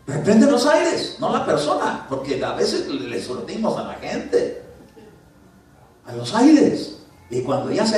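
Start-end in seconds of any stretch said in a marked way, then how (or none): 0.1-2.1 high, loud, slow rebuke; commanding rebuke. Shown as a demonstration. Preacher showing the church how to rebuke, high and commanding. 6.0-7.0 high and loud; commanding rebuke. Shown as a demonstration. Preacher rebuking the airs.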